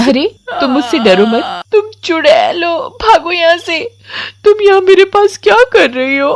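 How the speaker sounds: put-on high child voice, scared and tearful; frightened crying girl. Komal sees the witch and cries out in fear. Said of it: "डर जाती है और जोरों से रोने लगती".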